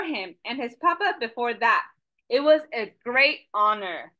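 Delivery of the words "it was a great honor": plain reading voice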